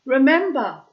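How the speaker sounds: high, loud call; God calling after the fleeing man; shouted. A narrator's reading. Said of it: "God shouted after him".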